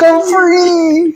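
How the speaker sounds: very high, drawn-out cry of freedom; mock celebration. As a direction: echo the joyous release way up high. Echoes the joke of being free.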